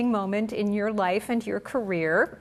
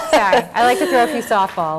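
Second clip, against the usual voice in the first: laughing; 5 semitones higher, 11 dB louder than usual. Real, not acted. Host laughing as she jokes about her softball question. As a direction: talk through laughter, louder.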